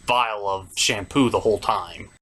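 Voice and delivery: ordinary talk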